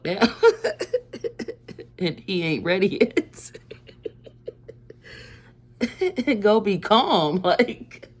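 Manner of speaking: loud, high, talking through laughter; real laughing fit between phrases; helpless laughter. Real, not acted. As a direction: push the words out while cracking up. Cracking up at own story.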